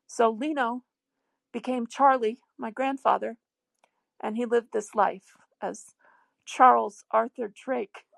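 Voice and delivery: ordinary talk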